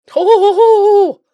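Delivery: high, loud ho-ho-ho laugh; santa laugh. Acted. Delighted at new goalkeeper.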